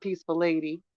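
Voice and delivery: ordinary talk, nothing marked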